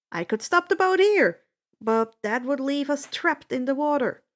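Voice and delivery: plain reading voice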